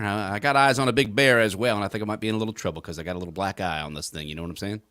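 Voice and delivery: lightly voiced trucker on the radio; good ol' boy chatting over CB. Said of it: "I just did that whole thing without my trucker hat on".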